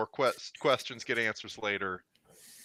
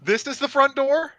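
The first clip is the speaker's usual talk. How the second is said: high voice; 12 semitones higher, 7 dB louder than usual. Acted. High voiced asking in character.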